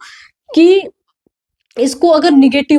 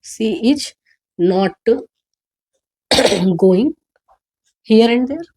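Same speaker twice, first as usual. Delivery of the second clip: slow dictation; much slower than usual. Shown as a demonstration. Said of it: "तो क्या हो जाएगा?".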